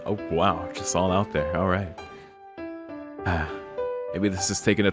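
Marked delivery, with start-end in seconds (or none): none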